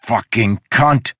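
loud, slow, venomous curse; spat curse. Acted. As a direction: spit the insult out slowly. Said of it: "Udo spat".

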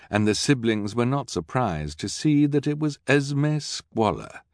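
ordinary delivery

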